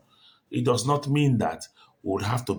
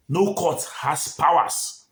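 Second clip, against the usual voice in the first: "No court has powers" slow and higher, each word weighted; stress on "No court"; 4 semitones higher, 3 dB louder, slower than usual. Real, not acted. Commentator repeating the legal limit, slow, emphatic "No court has powers". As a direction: hit "No" and "powers" hard, stretching the phrase. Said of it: "I emphasize on no court".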